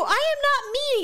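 high, drawn-out indignant protest; mock indignant denial. Acted quarrel with her son.

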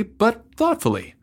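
plain reading voice

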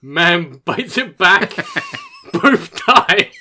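slow, loud headline breaking into laughter; headline delivery. Real, not acted. Finishing the snake headline for co-hosts, laughing headline delivery. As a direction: announce it weightily while cracking up. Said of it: "The way you delivered that was brilliant".

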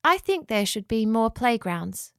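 plain reading voice

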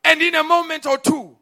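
preacher crying out the prophet's words; preaching at full force. Real, not acted. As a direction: command the storm from the pulpit.

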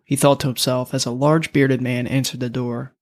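ordinary delivery

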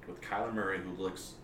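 normal talking voice

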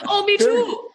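laughing shout of glee at a shared heritage; excited. Real, not acted. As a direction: burst out with delight.

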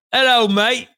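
loud, high-pitched mock British accent; hammed-up British accent. Shown as a demonstration. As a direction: greet them in a put-on British accent. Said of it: "working on my British accents".

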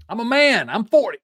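high, loud indignant outburst; hammed-up angry declaration; mock viral sports rant. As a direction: imitate an outraged coach shouting. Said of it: "dumb viral sports-related moments".

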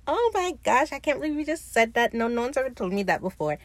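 high-pitched, blushing mock gush; bashful, flattered gushing. Fang answering Lucius's flirting, bashfully flattered. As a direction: imitate someone flustered by a compliment. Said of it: "Fang blushing like".

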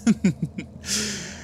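high, closed-mouth sinister chuckle; put-on villainous snicker; villain's chuckle. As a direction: chuckle like a scheming henchman. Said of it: "one of them goes".

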